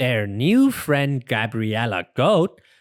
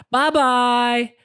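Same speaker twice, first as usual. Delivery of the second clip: calling out; 10 semitones higher, 4 dB louder than usual. Acted. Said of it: "they call to Gabriella Goat".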